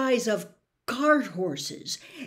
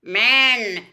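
loud spider cry; clicking spider cry; 2 semitones higher, 10 dB louder than usual. Acted. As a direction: spit the word out like a spider. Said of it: "clicked the spider".

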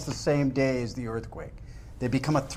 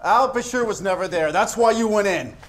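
higher-pitched, impatient jab; exasperated challenge; 7 semitones higher than usual. Acted. Interrogator challenges the suspect's account, exasperated.

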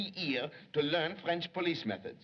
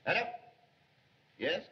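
normal talking voice

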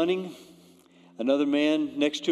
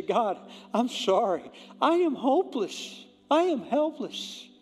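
higher, earnest pleading prayer; surrendering prayer; 8 semitones higher than usual. Shown as a demonstration. Pastor modelling the sinner's prayer, earnest and pleading. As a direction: pray it like giving up, pleading. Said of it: "You say".

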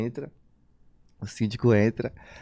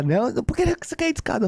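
mocking her, much higher and fast; outraged neighbour; 12 semitones higher, faster than usual. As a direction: ape the old woman's shocked high pitch. Vizinha indignada imitada. (imitando a vizinha chocada, bem mais aguda e rápida)

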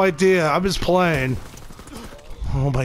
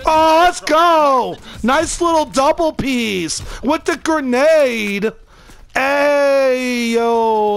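excited cheer; 7 semitones higher, much slower than usual. Real, not acted. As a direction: celebrate the double kill. Celebrating double kill.

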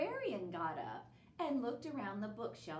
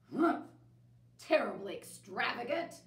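gruff exclamation; 6 dB louder than usual. Acted. Bear grumbling in library.

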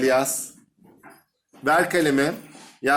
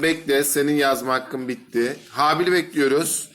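usual speaking voice

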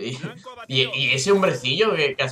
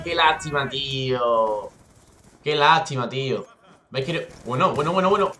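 ordinary talk

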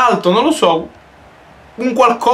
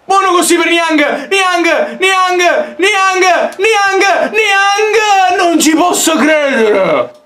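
rising excited shouts; excited shouting; 9 semitones higher, much slower than usual. Real, not acted. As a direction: yell the name over and over. Scoring chance in game.

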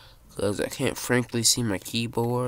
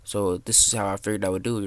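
usual speaking voice

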